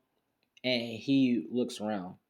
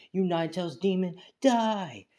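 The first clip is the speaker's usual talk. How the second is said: loud, raised-pitch battle cry; vengeful attack cry; 8 semitones higher, 3 dB louder than usual. Acted. Grieving woman charges Naruto with a kunai, vengeful cry. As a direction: hurl it as an attacker's accusation.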